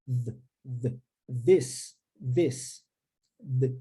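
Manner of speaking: "th" voiced with tongue between teeth, then "this". Shown as a demonstration. Teacher drills the voiced TH in "this". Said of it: "a língua assim vem entre os dentes".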